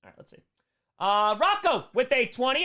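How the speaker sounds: ordinary talk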